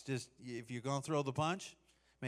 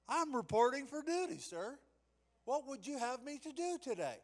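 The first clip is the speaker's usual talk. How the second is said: high, stiff soldier reporting; stiff barking toy soldier; 10 semitones higher than usual. Pretend. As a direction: snap to attention and report.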